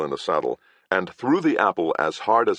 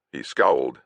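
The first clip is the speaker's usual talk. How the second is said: usual speaking voice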